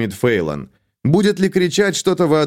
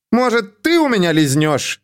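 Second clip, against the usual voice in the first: defiant bravado; 8 semitones higher than usual. A narrator's reading. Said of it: "хорохорилась Фейлон".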